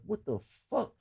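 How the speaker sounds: high soft what-the; baffled, almost breathless; stunned disbelief. Retold or imitated. Retold disbelief on the ground.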